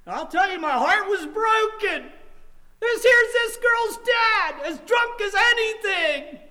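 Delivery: high, loud, slow cry; anguished preaching. Real, not acted. Preacher grieving a child's drunken father, anguished shout. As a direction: wail out every word with full force.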